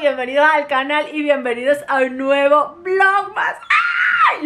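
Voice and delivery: bubbly, high and bursting with energy; energy at max. Real, not acted. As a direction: gush like you had way too much coffee. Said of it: "con las energías súper a tope".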